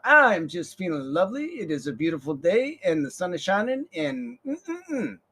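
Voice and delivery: high, dreamy sing-song; mock delighted Blanche; blissful lady. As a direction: savor the beautiful day aloud. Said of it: "She's like".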